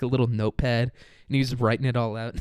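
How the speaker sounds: usual speaking voice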